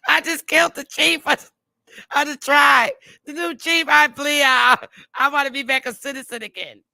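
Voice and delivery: high, loud crying shout for help; hammed-up sobbing yell for rescue; mock panicked plea.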